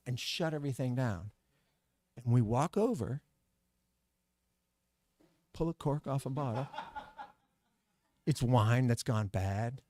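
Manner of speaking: ordinary delivery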